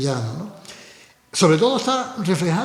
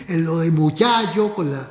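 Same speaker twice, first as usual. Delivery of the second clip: usual speaking voice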